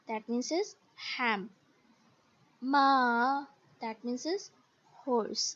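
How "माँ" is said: माँ dipping, then rising; long माँ with a scooped pitch; third-tone माँ, down and back up; falling-rising third tone. Shown as a demonstration. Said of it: "हर tones के साथ".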